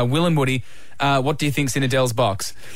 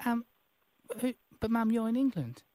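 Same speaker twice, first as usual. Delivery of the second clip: high, quiet put-on child voice; little boy Billy; 7 semitones higher, 8 dB quieter than usual. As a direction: play a small English boy, soft and pitched up. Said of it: "Billy? Bill-, Billy".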